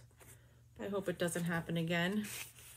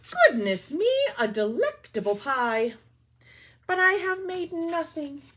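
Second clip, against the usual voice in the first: bear's line; 7 semitones higher, 6 dB louder than usual. Acted.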